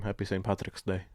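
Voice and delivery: ordinary delivery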